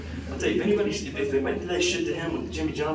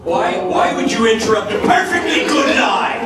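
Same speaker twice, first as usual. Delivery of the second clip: ordinary talk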